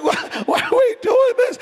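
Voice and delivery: very high, loud comic lament; mock wailing. Couple holding each other while fasting, mock wail.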